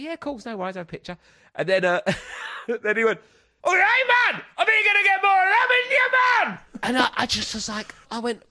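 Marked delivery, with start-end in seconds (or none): 0.0-1.2 much softer, higher-pitched agreement; hushed polite reply. Retold or imitated. Own polite reply to photo request. 3.6-6.6 high, very loud Geordie bellow; shouting Geordie bloke. Retold or imitated. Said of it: "then he screamed again".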